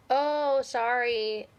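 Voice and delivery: mock apology. Ex pretending a text was accidental, mock apology. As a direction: feign an accidental slip, loudly. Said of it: "the accidental text".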